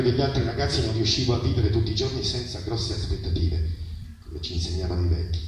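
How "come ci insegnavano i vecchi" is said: ordinary delivery, nothing marked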